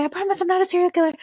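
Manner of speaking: high, rapid-fire blurt; retold frantic disclaimer; hurried reassurance. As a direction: rush out a pleading promise in a high voice. Retold hurried plea to star.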